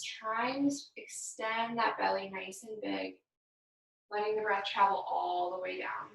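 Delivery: unhurried, gentle breathing guidance; guided meditation. Acted. Yoga instructor guiding the class through breathing, calm meditation voice. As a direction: lead the class calmly through each breath.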